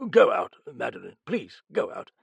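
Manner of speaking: halting, anxious plea; thick, nervous. A narrator's reading. Sir John begging Madeline to leave, nervous. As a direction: urge her out, words catching. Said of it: "Sir John began thickly, nervously".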